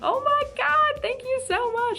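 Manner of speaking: very high, gushing thanks; thrilled squeal. Retold or imitated. Fan thanking the singer for a compliment, squealing. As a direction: re-enact an overwhelmed fan's squeal.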